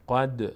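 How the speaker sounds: قد with a bouncing release on the d; qalqala on قد. Shown as a demonstration. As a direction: let the final d of قد echo briefly. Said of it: "نه".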